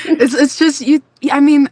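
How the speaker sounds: high, loud, laughing stammer; flustered laughter. Real, not acted. Guest flustered by host's praise, laughing. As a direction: stumble over the words through real laughter.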